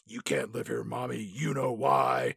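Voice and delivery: lightly voiced spooky little girl; eerie child whisper. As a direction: say it hushed and ominous. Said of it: "the daughter's like".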